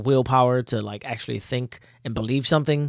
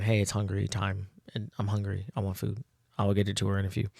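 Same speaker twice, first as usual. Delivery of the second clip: voicing her dog; 5 semitones lower, 11 dB quieter than usual. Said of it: "My dog is saying".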